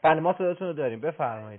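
loud reassuring call to a faint caller. Real, not acted. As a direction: project so the caller can hear you. Host raising voice over weak line.

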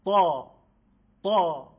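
تا said loud and thick, with the breath held back first; loud emphatic Arabic ta, said twice; heavy emphatic ta. Shown as a demonstration. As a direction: say تا with full heaviness, like a burst.

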